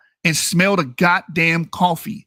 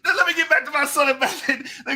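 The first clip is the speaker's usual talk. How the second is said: laughing; 6 semitones higher, faster than usual. Real, not acted.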